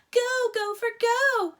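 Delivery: high, loud chant; shouting. A narrator's reading. Girls shout for the gopher, loud high cheer. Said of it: "shouted the girls".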